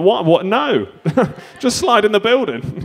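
high, baffled mock protest; incredulous refusal. Boss answering a daft slide request, incredulous refusal. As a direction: reject the daft idea in rising disbelief.

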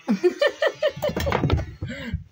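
squeaky high giggle; high-pitched giggle. Real, not acted. High laugh at raccoon.

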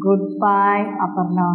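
slow and clear, stretched words; slow dictation. Shown as a demonstration. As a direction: spell it out slowly for the class. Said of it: "அவனு என்ன repeat பண்ணணும்?".

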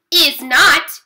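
loud, high contradiction; chortled retort. A narrator's reading. Brother teases sister about ballet, chortled retort. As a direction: fire back a taunting denial. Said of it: "he chortled".

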